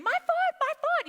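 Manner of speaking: high, loud yelp of pain, acted out; mock screaming. Injured brother in the church parking lot, mock screaming. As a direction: scream it like the boy whose foot is hurt. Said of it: "Mike is screaming".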